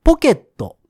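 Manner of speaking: ポケット said with Kansai pitch, high first mora; Kansai-style ポケット, pitch up front; 関西弁のアクセントでポケット; Kansai accent. Shown as a demonstration. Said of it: "関西弁はポケットや".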